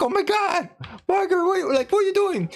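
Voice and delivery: high-pitched shocked outcry; imitated man panicking; mock horrified panic. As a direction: act out alarm, voice shooting up. He watches her decapitate the doctor.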